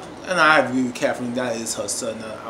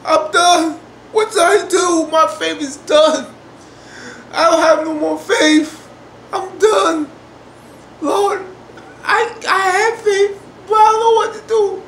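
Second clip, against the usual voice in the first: hammed-up anguished wail; imitating despair; 11 semitones higher, 7 dB louder than usual. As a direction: cry out to God as if faith is gone. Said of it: "She's like".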